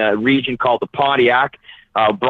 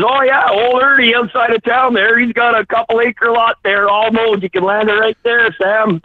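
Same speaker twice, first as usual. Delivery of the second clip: folksy mayor; 9 semitones higher than usual. Retold or imitated. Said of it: "So the mayor says".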